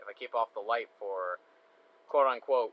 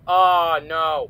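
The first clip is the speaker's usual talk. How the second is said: alarmed shout, much louder; panicked cry; 4 semitones higher, 15 dB louder than usual. Real, not acted. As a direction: yelp of real panic. Power at thirty-three percent.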